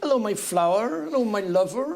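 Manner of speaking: imitated West Country greeting, lilting up at each end; West Country accent. Comedian shows how West Country folk greet each other, West Country accent. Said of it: "down in the West Country, that's where they say".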